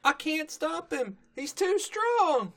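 high, slow straining voice; strained filly voice. Retold or imitated. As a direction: whine it while pulling hard. Apple Bloom straining to stop.

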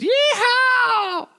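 very high, drawn-out cowboy holler; whooping cheer. Acted. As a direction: whoop it from the middle of a mob. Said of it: "raises his fist in the air and goes".

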